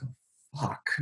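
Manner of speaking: hushed, dreading curse under the breath; scared hush. Retold or imitated. Driver dreading the cop's return, hushed curse. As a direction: barely voice the dread. Scared as the cop walks back.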